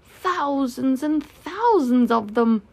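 amazed exclamation. Acted. Pooh seeing the bees, slow excited amazement. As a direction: marvel slowly at the sheer number.

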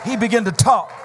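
high, loud, near-sung preaching climax; full-force preaching. Acted. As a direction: shout the miracle to the church, climbing and singing it out. Preacher builds to shouted climax.

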